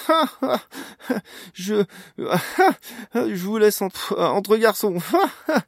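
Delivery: high, loud laughter breaking up the words; uncontrollable giggles. A narrator's reading. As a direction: try to talk through a laughing fit. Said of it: "Mila était en proie à un véritable fou rire".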